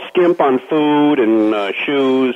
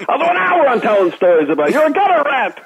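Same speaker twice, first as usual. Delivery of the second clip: mock rant; 13 semitones higher than usual. Imitating the ranting host.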